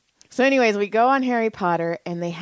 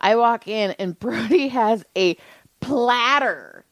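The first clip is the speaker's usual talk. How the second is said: ordinary delivery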